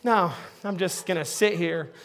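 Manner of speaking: a cat that can't be bothered to move; aloof cat. Retold or imitated. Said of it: "they're like".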